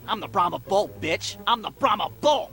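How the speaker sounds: low, taunting wrestler boast; imitated trash talk. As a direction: lightly play a wrestler talking trash. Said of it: "talking shit to him while I'm doing it".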